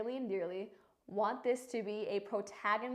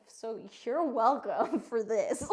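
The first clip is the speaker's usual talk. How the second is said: laughing; 5 dB louder, slower than usual. Real, not acted. Mock thanks to viewers.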